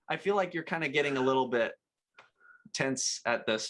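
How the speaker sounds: normal talking voice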